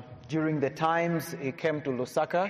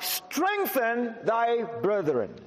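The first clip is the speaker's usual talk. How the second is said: raised, slowed King James reading; scripture reading; 5 semitones higher than usual. Acted. Preacher reading Luke aloud to the congregation, raised and proclaiming. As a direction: proclaim the verse to the hall.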